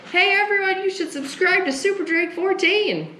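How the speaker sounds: high-pitched calling out to everyone; mock yell. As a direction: holler it across a school cafeteria, high-pitched. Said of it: "walk up to everyone in the cafeteria and yell".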